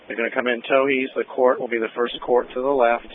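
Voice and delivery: usual speaking voice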